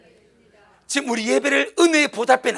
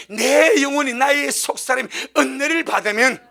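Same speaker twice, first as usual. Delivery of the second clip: ordinary talk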